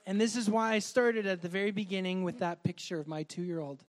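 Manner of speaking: ordinary delivery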